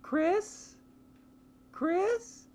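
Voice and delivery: high calling voice, rising; girl calling out. Retold or imitated. Girl calling from the stairs, high and rising. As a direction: call his name like a worried young woman, high. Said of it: "you hear".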